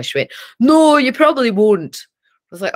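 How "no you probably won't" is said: loud, higher imitation of mum; mock dismissive retort. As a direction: shoot it down loudly, mock-scornful. Mum's blunt retort, acted.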